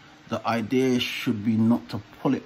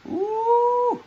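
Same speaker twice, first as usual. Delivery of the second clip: high squeal; 4 dB louder than usual. Real, not acted. Mask peeling off face.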